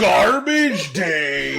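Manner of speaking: very slow, high, laughing announcer; silly drawn-out announcer. Acted. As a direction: stretch it out, laughing through it. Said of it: "I like that one even less".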